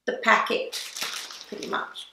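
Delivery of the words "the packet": usual speaking voice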